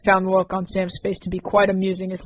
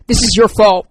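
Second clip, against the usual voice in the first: louder sharp accusation; accusing; 2 semitones higher, 10 dB louder than usual. Acted. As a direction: jab the blame at him. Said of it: "Rain accused him".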